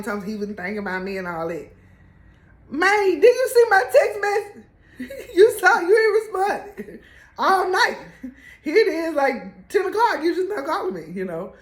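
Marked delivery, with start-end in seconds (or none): 2.7-11.1 higher, louder, laughing exasperation; exasperated confronting. Retold or imitated. Barbara confronting the coach about ignoring her text, loud and laughing. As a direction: call him out, half laughing.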